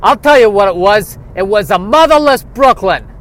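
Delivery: loud, high tough-guy Brooklyn accent. Shown as a demonstration. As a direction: swagger it like a New York wiseguy. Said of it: "the accent is in the name".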